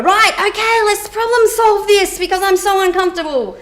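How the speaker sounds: hammed-up urge to jump in and fix it; flustered fixer. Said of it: "I wanna jump in and kinda say".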